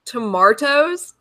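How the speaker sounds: slow "tomatoes" with a broad "mar", rising; quoted pronunciation. Retold or imitated. Repeating what Pine Snake says, slow questioning "tomatoes". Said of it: "So Pine Snake says it's".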